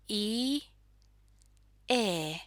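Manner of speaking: i, e as separate neutral vowels. Shown as a demonstration. Teacher showing learners the neutral vowels i and e, separately sounded. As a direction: sound out Finnish i, then e. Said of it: "pronounced in the middle of the mouth".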